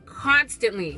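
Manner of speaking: usual speaking voice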